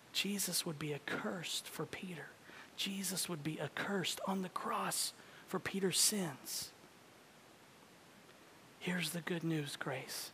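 soft solemn whisper. Acted. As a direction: whisper it with quiet awe. Solemn climax of the sermon.

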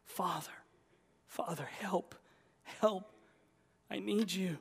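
breath-soft, whispered plea; hushed cry for help. Acted. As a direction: call on the Father, barely voiced. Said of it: "run to him and cry".

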